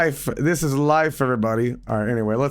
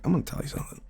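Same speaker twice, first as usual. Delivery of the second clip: hissed low whisper of a creepy intruder; low breathy menace, quietly contemptuous; menacing whispered stranger; 6 semitones lower, 6 dB quieter than usual. Retold or imitated. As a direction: lean in and whisper the threat. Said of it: "He's like".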